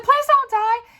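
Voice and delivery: put-on high, loud pleading girl's voice; high pleading plea. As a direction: beg him like a frightened girl in a movie. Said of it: "she's like".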